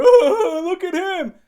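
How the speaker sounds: very loud, high mock hooting; mocking gawkers. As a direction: jeer like an exaggerated gawking onlooker. Mimics guys gawking at leaks.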